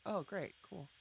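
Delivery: ordinary talk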